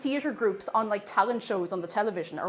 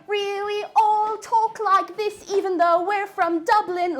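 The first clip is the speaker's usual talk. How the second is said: higher, slow, put-on posh accent; posh stage-school kid; 10 semitones higher, 6 dB louder, slower than usual. Mocking Billy Barry kids, slow posh stage voice. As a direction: ham up a stage-school child from Dublin. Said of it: "Billy Barry kids".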